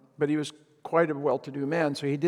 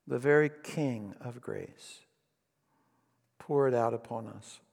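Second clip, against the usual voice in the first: closing prayer. Real, not acted. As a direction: pray humbly over the congregation.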